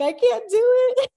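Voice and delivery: high, loud, whining wail of self-doubt; whiny despair. Retold or imitated. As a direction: squeal it like a panicked new mom. Self-doubt retold to encourager.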